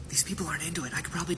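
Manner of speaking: whispered scheming aside; conspiratorial whisper. Retold or imitated. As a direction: lean in and whisper the plan.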